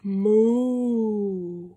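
low it out like a cow; cow moo. Acted. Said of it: "All I know is cows go".

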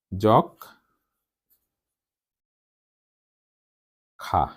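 যক, long pause, then nasalised খা; syllable-by-syllable demo. Shown as a demonstration. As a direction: dictate যক ... খা in two pieces. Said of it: "নাসিক্য উচ্চারণ হওয়ার কথা".

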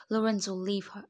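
loud, sharp narrated bark; barked command. A narrator's reading. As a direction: bark the order out loudly. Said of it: "I barked".

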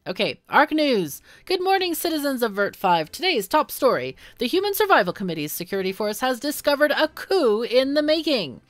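bright upbeat TV anchor; newscaster voice. Acted. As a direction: read it like the evening news anchor. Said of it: "my best newscaster voice".

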